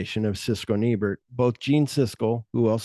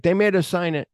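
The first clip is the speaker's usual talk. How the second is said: high-pitched mock protest; retold protest; 8 semitones higher, 3 dB louder, faster than usual. Student telling his teacher they were forced, retold protest. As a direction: plead it higher, imitating an upset student.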